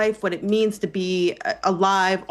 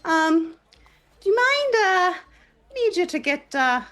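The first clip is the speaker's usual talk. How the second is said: high, sweet, slow and polite; 8 semitones higher, slower than usual. Acted. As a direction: ask very sweetly, drawn out. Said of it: "just gonna pull a full babe and go".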